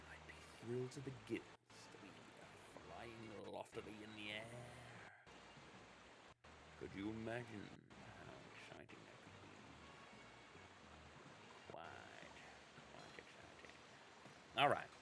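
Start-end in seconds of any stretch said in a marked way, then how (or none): 0.1-9.6 airy put-on voice, fast then soft; posh whimsy. Host daydreaming about flying, in a soft put-on voice. 11.7-13.7 very low, then whispered quite; whispered aside. Pretend. Host answering himself in a hushed low whisper.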